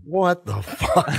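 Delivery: high, slow stunned imitation; mock stunned disbelief. Imitating the whole room's reaction, high drawn-out disbelief. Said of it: "everyone in the room was just like".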